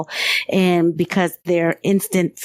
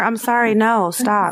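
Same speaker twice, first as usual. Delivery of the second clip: ordinary talk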